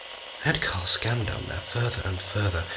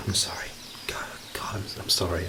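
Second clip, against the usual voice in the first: breathless whisper, stumbling over the words; hushed, rattled whisper of regret; whispered apology; 6 dB quieter than usual. Acted. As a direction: whisper it, ashamed and shaken. Apologising after upsetting colleague.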